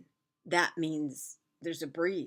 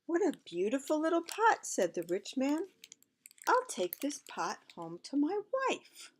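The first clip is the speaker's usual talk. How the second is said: rich man's voice; 9 semitones higher than usual. Acted.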